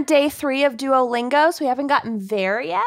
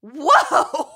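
excited whoa; 5 semitones higher, 3 dB louder than usual. Retold or imitated. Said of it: "my body is just like".

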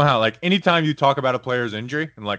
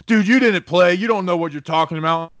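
mock angry fan; 5 semitones higher, 4 dB louder than usual. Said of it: "I'll get someone be like".

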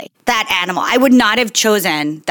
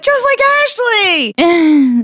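higher, drawn-out mock cry; retold exclamation; 8 semitones higher, 4 dB louder, much slower than usual. Retelling her reply to cousin about co-host, exaggerated exclamation. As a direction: sing out the comparison, stretching every word.

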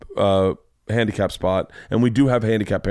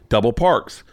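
normal talking voice

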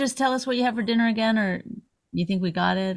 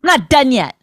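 louder, higher snap in a put-on voice; angry celebrity; 4 semitones higher, 6 dB louder than usual. Ranting host mocks a celebrity snapping at the piano player, loud. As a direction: bark it like a diva cutting off the band. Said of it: "the celebrity would be like".

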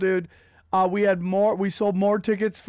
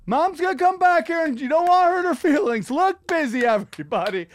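a light high-voiced boss urging idle staff; mildly mock a manager telling staff to look busy; hint of a bossy supervisor, higher and a bit louder; Chelsea Clinton; 7 semitones higher, 3 dB louder than usual.

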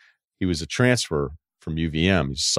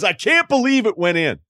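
put-on much higher, louder old coach voice; Lou Holtz impression; 13 semitones higher, 4 dB louder than usual. To a young staffer, old coach asks about the kick in a high, strained voice. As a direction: pitch it up like an old football coach.

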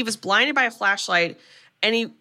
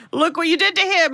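higher, raised accusing cry; accusing outburst; 9 semitones higher, 3 dB louder than usual. Retold or imitated. Mrs Voorhees turning on Alice, raised accusing cry. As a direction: throw the blame at her.

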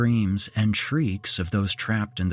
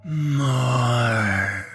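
lifeless, hollow single word; a corpse-like voice giving an order; eerie dead voice; 4 semitones higher, 3 dB louder than usual. A narrator's reading. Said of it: "That dead voice".

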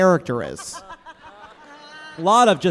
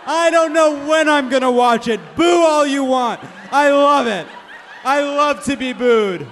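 sneering wrestling villain; 8 semitones higher, 8 dB louder than usual. Acted. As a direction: goad the crowd to boo you. Goading the crowd to boo.

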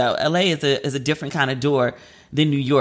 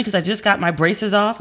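ordinary delivery

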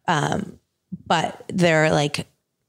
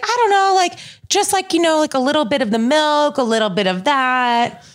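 mom imitation; 7 semitones higher than usual. Mom answering her daughter's recipe question, high-pitched imitation. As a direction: imitate mom being vague in a raised voice. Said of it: "my mom will be like".

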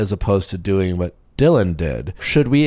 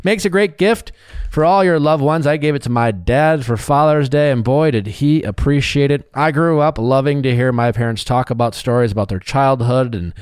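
upbeat sponsor read, higher and bright over music; 5 semitones higher than usual. Acted. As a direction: sell it like a cheerful radio spot.

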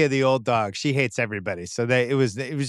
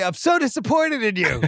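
disappointed parent; 8 semitones higher than usual. Retold or imitated. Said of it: "I said to Willie".

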